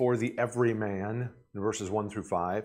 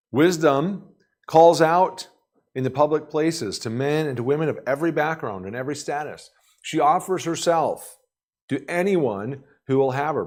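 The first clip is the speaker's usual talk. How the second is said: fervent preaching; 5 semitones higher, 5 dB louder than usual. Acted. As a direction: lift it like a sermon climax. Preaching, building to Proverbs.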